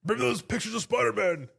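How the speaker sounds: badly faked newspaper-editor demand; J. Jonah Jameson. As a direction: put on a pushy boss and pitch it way up. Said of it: "That was a bad impression".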